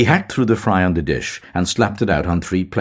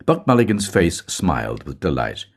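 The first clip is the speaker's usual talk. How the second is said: ordinary delivery, nothing marked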